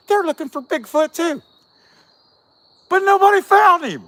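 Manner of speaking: high, loud exasperated cry. Real, not acted. Vlogger venting about the show, loud exasperated. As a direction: throw up your hands, nobody ever found him.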